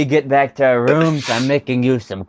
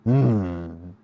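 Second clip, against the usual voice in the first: thinking aloud; 4 semitones lower, 6 dB quieter, slower than usual. Acted. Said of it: "I'm kinda thinking out loud".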